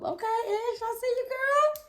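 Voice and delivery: high, loud hype voice; hyped up. Real, not acted. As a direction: cheer her on, all hyped up. Cheering on his teammate.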